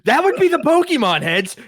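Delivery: high, loud victory call; triumphant gotcha. Real, not acted. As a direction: spike the answer like a winning point. Triumphant punchline in debate.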